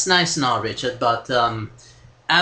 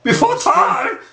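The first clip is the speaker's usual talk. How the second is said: high incredulous; 4 dB louder, slower than usual. Acted.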